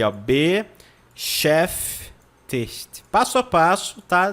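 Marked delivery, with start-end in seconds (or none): 0.1-3.0 "Beschäftigt" very slow, in three separate syllables; "Beschäftigt" stretched out with pauses; step-by-step demo. Shown as a demonstration. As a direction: sound out be, schäf, tigt for a learner. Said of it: "tente pronunciar aí".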